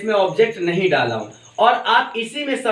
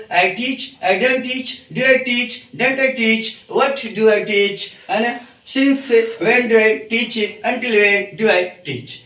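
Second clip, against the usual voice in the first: slow, deliberate English drill; slow drill pronunciation; much slower than usual. Shown as a demonstration. Teacher drilling question forms for the class, slow. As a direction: spell out each question word by word. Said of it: "pronunciation पे भी ध्यान देंगे".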